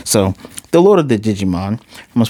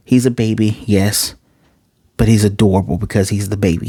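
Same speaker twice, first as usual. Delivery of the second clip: ordinary talk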